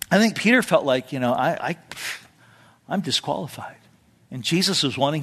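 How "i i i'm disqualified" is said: softer, halting admission of shame; ashamed. Retold or imitated. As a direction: falter over the words. Peter's shame.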